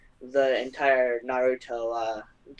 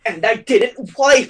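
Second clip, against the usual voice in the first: loud, high comic wail; hammed-up, slow high confession; mock confession; 9 semitones higher, 12 dB louder, slower than usual. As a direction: draw it out loudly in a put-on voice.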